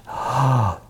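breathy, voiceless acted gasp; dismayed gasp. Jean hears she must apologize, dismayed gasp. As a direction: gasp in mock dread.

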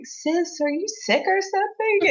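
voz bem mais aguda, imitando a irmã; imitating sick sister. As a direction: mimic her high, surprised question. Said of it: "she's like".

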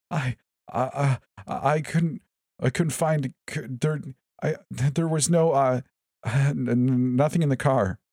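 high, broken, drugged stammer; slurred. A narrator's reading. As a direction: stumble over every word. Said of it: "I slurred".

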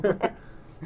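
short high breathless wheeze-laugh; Squidward laugh. Shown as a demonstration. Second try at the Squidward laugh, high and airy. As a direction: squeeze out a high airy honk. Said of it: "me doing a Squidward laugh".

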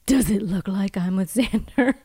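hushed, acted-out whispered comeback; whispered retort. Acted retort in retold scene.

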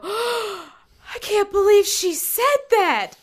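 high, slow gasping disbelief; shocked friends. Retold or imitated. Girls reacting to the mom's comeback, high shocked disbelief. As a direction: gasp it like a scandalized schoolgirl. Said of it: "her friends go".

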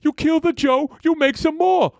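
a retold football player barking at a coworker; Terry Tate yell. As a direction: bellow like a furious office enforcer. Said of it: "he'll be like".

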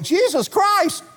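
high, frantic cry to wake him; panicked shout. Retold or imitated. Simon Peter waking Jesus in the storm, high panic. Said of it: "Simon Peter ran up to him and went".

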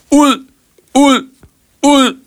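meget højt, skrigende "Ud!"; skrig. A narrator's reading. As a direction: skrig ham ud af huset. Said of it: "skreg Lærke". (rasende skrig mod Marco)

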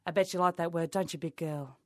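normal talking voice

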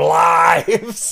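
slow, loud excited drawl; excited shout. Real, not acted. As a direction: stretch it out in mock delight.

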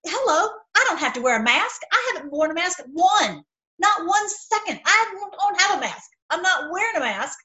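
emphatic, exasperated defiance; defiant stand. Acted. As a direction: stand up for yourself, voice riding high and worked up. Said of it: "help everyone to stand up and say".

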